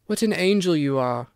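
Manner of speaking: a lightly voiced boy, admiring; young Duke crying out in admiration; cried out. A narrator's reading. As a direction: exclaim it with wonder. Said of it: "cried the young Duke".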